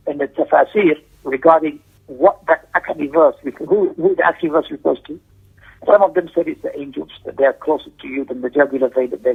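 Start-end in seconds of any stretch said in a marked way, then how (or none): none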